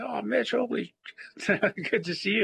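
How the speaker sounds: delighted greeting. Retold or imitated. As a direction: imitate friends happily recognizing someone. Old friends greet him warmly.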